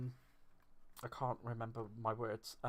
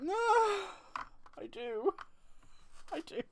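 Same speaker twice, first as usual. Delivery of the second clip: squeaky higher mock admission to the boss; mock sheepish reply; 4 dB louder than usual. Imagined reply to her boss, mock squeaky giving in. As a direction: play a caught-out little voice, half joking. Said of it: "and I'll be like".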